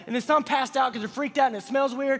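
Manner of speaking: normal talking voice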